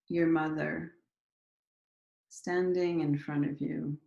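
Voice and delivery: unhurried meditation leader, pausing after each prompt; guided meditation. Acted. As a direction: guide listeners gently into their bodies, leaving space between phrases.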